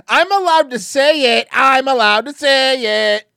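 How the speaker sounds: high, loud mock protest; defiant shouting. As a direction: yell it in self-defence. Defiantly defending own joke.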